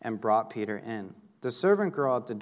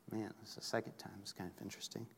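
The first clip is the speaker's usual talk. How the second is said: much softer, quick aside; hushed, hurried musing; muted inner thought; 16 dB quieter, much faster than usual. Pretend. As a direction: voice a private thought under your breath. Imagines Peter's private thought.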